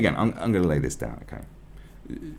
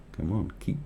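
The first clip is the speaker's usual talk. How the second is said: ordinary talk, nothing marked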